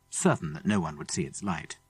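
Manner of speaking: plain reading voice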